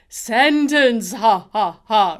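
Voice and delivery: sentence as a loud Count ha, ha, ha; loud vampire Count voice. Shown as a demonstration. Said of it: "pretending to be the Count from Sesame Street".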